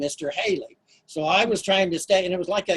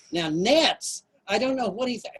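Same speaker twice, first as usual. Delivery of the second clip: ordinary talk, nothing marked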